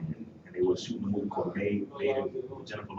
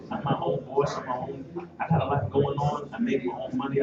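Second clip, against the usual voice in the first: usual speaking voice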